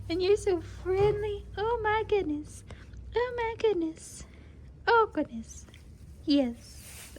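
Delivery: high, soft cooing to the foal. Real, not acted. Cooing softly at the cute foal in the stall. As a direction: coo sweetly over the little one.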